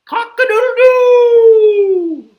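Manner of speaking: very loud, high crow; rooster crow. Acted. Reader plays the rooster for kids, piercing crow. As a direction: crow at full blast, holding the last note. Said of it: "He can sound like a rooster".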